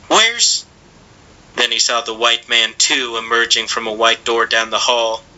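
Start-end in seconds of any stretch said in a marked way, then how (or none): 0.1-0.6 high breathless cut-off question; boy panting. A narrator's reading. Boy gasping at the door, high panting. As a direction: gasp it out, broken off.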